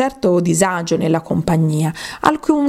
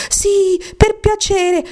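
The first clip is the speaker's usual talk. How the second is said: everyone begs the mouse to come back; chorus pleading; 14 semitones higher, slower than usual. A narrator's reading. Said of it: "e tutti gli altri in coro". (coro implorante, molto acuto)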